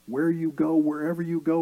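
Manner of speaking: ordinary delivery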